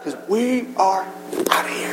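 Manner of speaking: secret-sharing whisper; hushed. Acted. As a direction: lean in and breathe the last words. Hushed punchline after climax.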